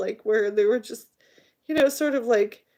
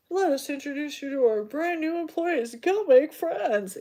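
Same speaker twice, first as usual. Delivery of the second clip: mock corporate chirp; 6 semitones higher than usual. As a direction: parody a perky manager. Mocking corporate announcement.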